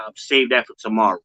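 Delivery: ordinary delivery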